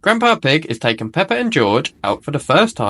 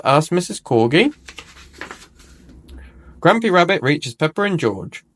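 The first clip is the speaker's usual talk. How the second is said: usual speaking voice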